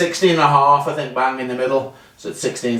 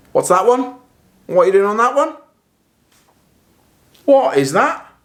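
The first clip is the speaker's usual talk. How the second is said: higher, louder baffled questions; puzzled by the mark on the face; surprised; 8 semitones higher, 4 dB louder than usual. Real, not acted. Shocked by a bad strike mark.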